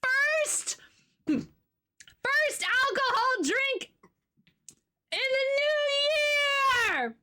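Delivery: high, slow drawn-out cheer; excited squeal. Real, not acted. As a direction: stretch it out celebrating. Celebrating first drink.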